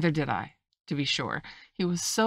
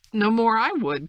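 higher, louder exclamation; heated cry; 7 semitones higher, 8 dB louder than usual. A narrator's reading. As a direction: cry it out as an indignant retort. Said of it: "cried the squire".

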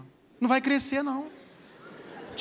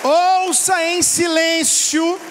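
every word slow and louder; slow emphatic order; 6 dB louder, much slower than usual. Real, not acted. Pastor tells the wives to listen in silence, slow and emphatic. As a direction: drive the instruction home word by word.

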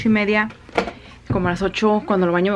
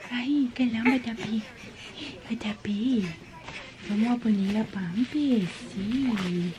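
soft whisper; 7 dB quieter, slower than usual. Real, not acted. Mother whispering softly to her sleepy baby. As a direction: whisper gently.

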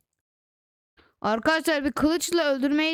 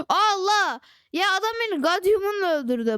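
real disbelief, higher and faster; shocked outburst; 6 semitones higher than usual. Real, not acted. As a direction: cry out at being killed. Killed by another player.

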